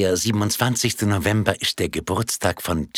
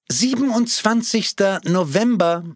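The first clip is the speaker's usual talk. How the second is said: higher called answer; 11 semitones higher, 3 dB louder than usual. Acted. Woman finally calls out the answer, higher. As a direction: call the date out. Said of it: "rief endlich jemand".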